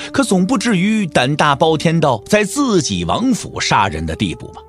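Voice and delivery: ordinary talk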